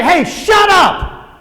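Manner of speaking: sharp, drawn-out mock shout; telling off. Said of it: "unless you say".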